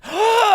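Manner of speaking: sudden high gasp of recognition; a quick sharp intake of breath, delighted; surprised gasp. Retold or imitated. Retelling hotel lobby chat, a high surprised gasp.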